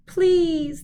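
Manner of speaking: high loud pleading wail. A narrator's reading. Injured fox wailing to the dentist for mercy. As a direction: wail the plea like it hurts. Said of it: "the fox wailed".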